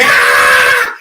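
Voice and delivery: high, loud startled shriek; scared scream. Retold or imitated. Boy hears a Bigfoot scream, loud frightened shriek. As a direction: shriek like a terrified child.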